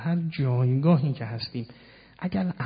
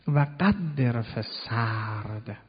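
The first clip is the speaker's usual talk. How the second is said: «و قدر فی السرد» drawn out word by word, very slow; slow recitation; 2 semitones lower, much slower than usual. Shown as a demonstration. Preacher to congregation, repeating the verse very slowly. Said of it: "سرد مثل سرد و گرم".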